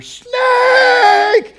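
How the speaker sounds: high, piercing yell of "Snake!"; a wife screaming from downstairs; shrieked alarm. Retold or imitated. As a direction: scream it like you just spotted one.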